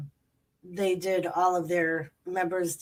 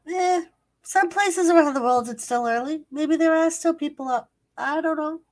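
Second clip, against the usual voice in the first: high voice; 9 semitones higher, 6 dB louder than usual. Retold or imitated. Retold own thought, high voice.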